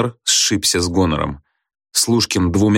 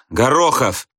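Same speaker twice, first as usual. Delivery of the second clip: slightly raised name call from the register; roll-call; 5 semitones higher, slower than usual. A narrator's reading. Teacher reads the next name, calling it out. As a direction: call the surname as a checking teacher.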